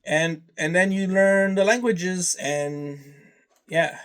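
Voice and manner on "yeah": usual speaking voice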